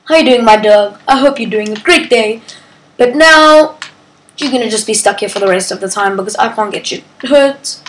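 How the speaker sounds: ordinary talk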